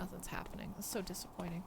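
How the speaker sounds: toonloos fluisteren, teleurgesteld; whisper. Real, not acted. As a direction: breathe it out, deflated. Quiet game moment, whispering.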